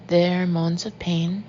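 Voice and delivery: usual speaking voice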